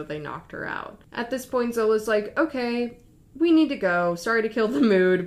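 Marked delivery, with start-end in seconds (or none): 2.4-5.3 retold, louder and higher, urgent; firm urgent announcement. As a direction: break in loudly, wanting out. Retold quote, Zola insists on leaving.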